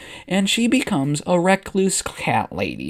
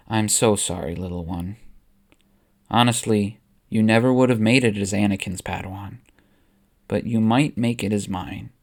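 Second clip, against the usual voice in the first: gentle comfort; 6 semitones lower than usual. Acted. Obi-Wan comforting Ahsoka.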